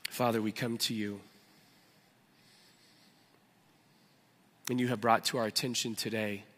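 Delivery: quiet congregational prayer. Acted. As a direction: pray humbly for the room. Leads a prayer.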